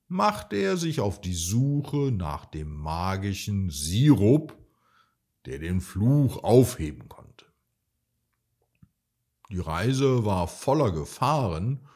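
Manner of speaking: usual speaking voice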